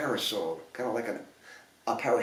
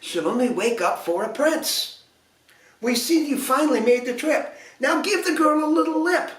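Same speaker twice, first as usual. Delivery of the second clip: higher, scolding fairy voice; scowling fairies; 9 semitones higher than usual. A narrator's reading. Fairies to the dense prince, high scowling scold. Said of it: "The fairies scowled".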